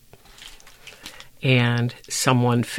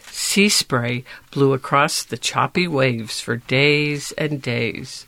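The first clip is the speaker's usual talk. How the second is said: ordinary delivery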